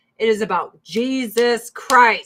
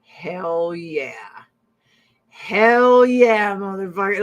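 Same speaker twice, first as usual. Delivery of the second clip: lowered bro voice, bursting louder on the second hell yeah; husband's voice; 5 dB louder, slower than usual. Retold or imitated. Husband won over by her lasagna, hammed-up bro cheer. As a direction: imitate a converted lasagna fan. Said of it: "He was just like".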